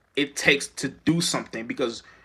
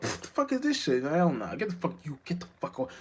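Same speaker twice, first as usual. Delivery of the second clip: disgusted; 2 semitones higher, 4 dB quieter than usual. Acted. Acted disgusted rejection.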